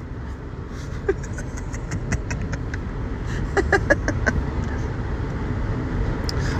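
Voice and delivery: long unvoiced wheezing laugh, rising high; high breathy laugh. Real, not acted. Laughs at own joke.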